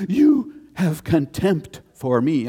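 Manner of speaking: high, loud, slow, hammed-up offended churchgoer; a touchy listener pouncing on the pastor; mock offended gasp. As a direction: gasp then accuse, overacted.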